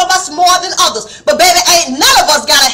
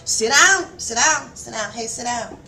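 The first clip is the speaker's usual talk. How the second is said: a hint of a mother fading softer; lightly voiced mom shooing kids down; mother telling children to sit, softer each time; mama telling kids; 4 semitones lower, 8 dB quieter than usual. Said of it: "how your mama used to tell you".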